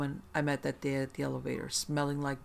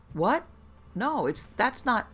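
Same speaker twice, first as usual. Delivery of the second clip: high, startled and broken off; baffled protest; 8 semitones higher, 4 dB louder, slower than usual. A narrator's reading. Therapist hears the girl called a binge eater, baffled and protesting. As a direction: blurt a confused denial, stumbling to a stop. Therapist confused by mistaken identity.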